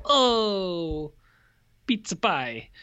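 put-on playful Italian accent caricature; mock Italian accent. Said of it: "done the Italian accent".

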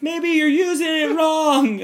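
very high, loud, slow put-on voice; mock actress hint. Actress nudging players about the key, slow high mock voice. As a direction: drawl it out sweetly high.

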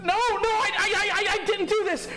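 high frantic pleading shout; desperate, disbelieving, near tears; panicked denial. Retold or imitated. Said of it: "and I'm like".

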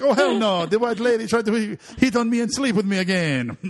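hammed-up Jamaican bartender refusing; Jamaican accent. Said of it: "That's a pretty good accent".

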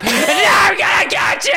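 rising to a high scream. Pretend. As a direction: build into a wild yell. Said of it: "before I started screaming".

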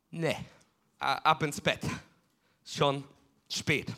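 a lightly voiced grumpy priest; sleepy old priest. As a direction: mumble it like a tired old priest sending a child to bed. Sleepy priest sends boy back.

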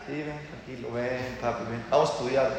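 soft, low, coaxing voice; soothing teacher. Acted. Teacher soothing a frightened pupil, soft and low.

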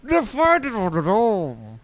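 high, garbled British cartoon voice; Nigel Thornberry. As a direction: slur it nearly beyond recognition as a cartoon Brit. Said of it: "what the hell he's saying".

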